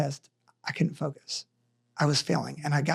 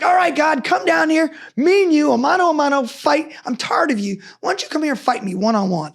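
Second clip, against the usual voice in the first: screaming at God; 8 semitones higher than usual. Retold or imitated. Man in the rain screaming at God to fight him. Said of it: "Like, I'm screaming".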